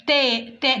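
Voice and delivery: "تاء" said through a smile, light t; smiling light ta. Shown as a demonstration. Teacher shows the mouth shape, smiling light "تاء". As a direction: smile as you say a thin "تاء". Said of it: "فاتحة بقي كأنه مبتسمة".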